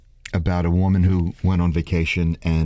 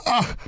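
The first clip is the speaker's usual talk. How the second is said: disgusted ugh. Retold or imitated. Disgusted hairdresser grunt.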